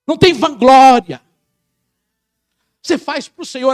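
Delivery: high, loud preaching over the music; full-force preaching. Acted. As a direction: drive it home like a pulpit climax. (pregação inflamada, alta e forte)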